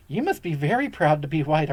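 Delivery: ordinary delivery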